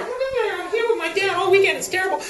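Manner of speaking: put-on complaining kid. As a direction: whine into the phone as a bored kid. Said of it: "The other one's on the horn".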